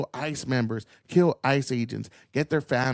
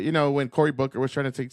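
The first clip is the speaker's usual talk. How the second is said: ordinary talk, nothing marked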